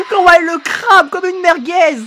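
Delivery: high, loud wow breaking into laughter; amazed, laughing shout. Real, not acted. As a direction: exclaim through gleeful laughter. Streamer delighted by attack. (cri émerveillé mêlé de rire)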